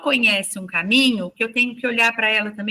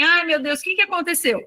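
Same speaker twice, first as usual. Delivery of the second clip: impatient; 6 semitones higher than usual. Retold or imitated. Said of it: "Eu já tava sem paciência".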